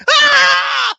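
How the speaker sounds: sudden high yelp of pain; high scream. Retold or imitated. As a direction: shriek like you just got hit. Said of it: "the ref who has to scream".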